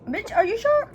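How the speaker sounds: very high-pitched doubting question; high, skeptical reaction; incredulous. Retold or imitated. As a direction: squeak it out in mock disbelief. Repeats her disbelieving reply.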